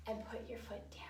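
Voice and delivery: soft whispered cue; hushed dance teacher whisper. Real, not acted. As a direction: whisper the instruction gently.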